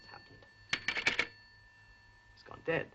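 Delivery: ordinary talk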